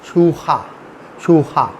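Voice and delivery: "शूखा" with a throaty kh in the middle; Spanish J as kh. Shown as a demonstration. Teacher answering how Spanish says it, J as kh. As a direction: say "शूखा", kh where j was. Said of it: "Spanish में क्या हो जाएगा".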